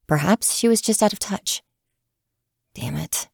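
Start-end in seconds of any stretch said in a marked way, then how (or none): 2.7-3.3 low muttered curse under the breath; frustrated mumble to herself; mumbled. A narrator's reading. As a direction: mutter it to yourself. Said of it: "she mumbled to herself".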